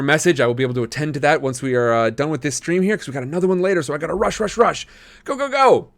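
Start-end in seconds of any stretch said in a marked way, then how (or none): 5.2-5.9 excited. Real, not acted. Streamer rushing to end stream.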